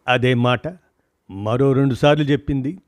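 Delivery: usual speaking voice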